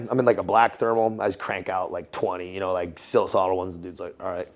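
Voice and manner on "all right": soft, lower, offhand acknowledgement; retold low-key approval. Officer after watching pull-ups, low-key approval. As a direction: toss it off quietly and low. Retelling the dude's unimpressed approval.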